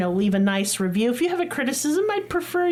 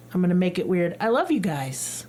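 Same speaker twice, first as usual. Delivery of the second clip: ordinary talk, nothing marked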